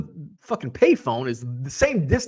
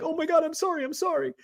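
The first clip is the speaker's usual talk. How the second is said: high, small mock-panicked voice; soft, high-pitched pleading; panicked apology; 13 semitones higher, 4 dB quieter, faster than usual. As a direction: apologize like a scared little kid. Child's panicked apology to old man.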